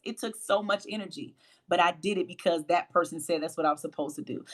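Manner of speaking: normal talking voice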